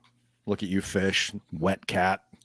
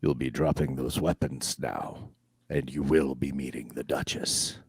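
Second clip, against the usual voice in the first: menacing guard; 6 semitones lower, 3 dB quieter than usual. Acted. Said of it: "points it at you and says".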